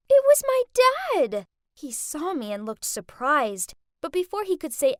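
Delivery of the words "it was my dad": much higher, loud disbelief; shocked. A narrator's reading. Narrator spotting her dad as valet, shocked and loud. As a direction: let the shock burst out.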